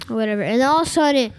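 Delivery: normal talking voice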